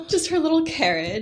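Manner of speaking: laughing. Real, not acted. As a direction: giggle through the words. Cooing over toddler's wagon parade.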